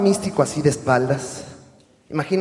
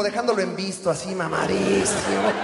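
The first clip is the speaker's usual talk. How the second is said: usual speaking voice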